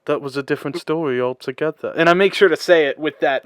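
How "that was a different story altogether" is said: British roadie accent. Shown as a demonstration. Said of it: "I try to say it with that accent".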